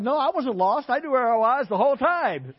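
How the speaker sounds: put-on high, loud kid's insistence; a child insisting he was never lost, high and loud; indignant little boy. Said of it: "He's like".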